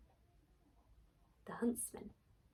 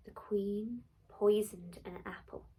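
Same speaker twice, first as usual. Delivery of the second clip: plain reading voice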